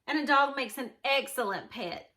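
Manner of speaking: ordinary delivery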